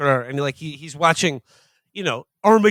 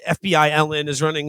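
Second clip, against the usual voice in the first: normal talking voice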